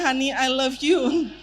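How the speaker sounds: slow, tender honey I love you; tender demo. Shown as a demonstration. Husband saying it to his wife, slow and tender. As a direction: say it slowly to your wife. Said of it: "Ngomongnya sambil lihat ke istrinya".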